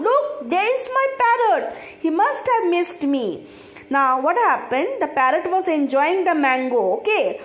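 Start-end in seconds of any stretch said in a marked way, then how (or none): 0.1-3.3 higher-pitched, mildly excited storybook exclamation; crying out. A narrator's reading. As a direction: read the aunt's cry with a lift in pitch, without shouting. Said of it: "came out of her room and cried out".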